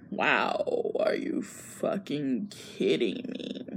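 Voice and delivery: low, slow, whispered exasperation; exasperated whisper. Retold or imitated. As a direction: mutter it in disbelief. Miguel reacts to amnesia news.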